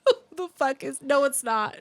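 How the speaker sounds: high, loud, fast laughing protest; laughing disbelief. Real, not acted. Co-host laughing at the wrestler's photo, disbelieving.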